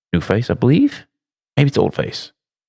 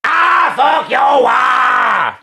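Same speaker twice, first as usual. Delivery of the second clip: high, loud, very drawn-out yell; stretched shout; 4 dB louder, much slower than usual. Real, not acted. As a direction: drag the shout out long and loud. Reacts to a crude username.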